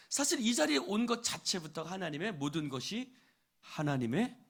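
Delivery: ordinary talk, nothing marked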